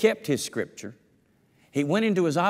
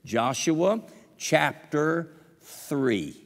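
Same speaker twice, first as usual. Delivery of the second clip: "Joshua chapter three" very slow and deliberate; slowly repeated reference; even slower emphasis; 3 semitones higher, much slower than usual. Shown as a demonstration. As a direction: drag each word for memory. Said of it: "I want you to remember this".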